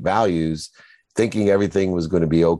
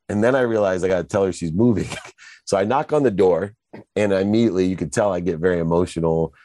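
ordinary talk, nothing marked

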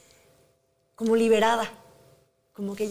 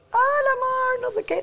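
much higher, bright put-on cheer over sadness; forced cheerful greeting. Mother greeting her daughters home, forced cheerful voice. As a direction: greet the kids sounding happy though hurting. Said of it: "Y las recibía".

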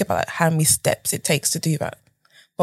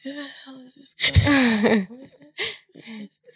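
incredulous stare; 8 semitones higher than usual. Retold or imitated. Organiser sizing up a newcomer, mock disbelief. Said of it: "Charlene looked at me like".

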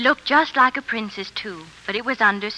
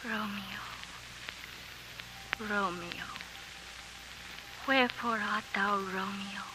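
Juliet recitation; 8 dB quieter than usual. Acted. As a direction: recite Juliet's balcony speech softly. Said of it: "leave this one light on my face".

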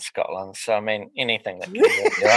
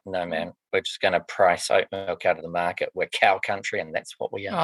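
low mock local voice; 8 semitones lower, 4 dB quieter than usual. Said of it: "They're like".

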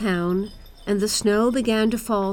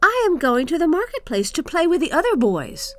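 put-on high boy's call, moderately raised; shouting; 8 semitones higher, 4 dB louder than usual. Kai to Gerda as he leaves to play, shouting. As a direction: call out as a boy, pitched up. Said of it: "He shouted to Gerda".

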